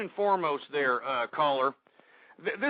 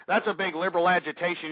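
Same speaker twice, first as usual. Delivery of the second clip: ordinary talk, nothing marked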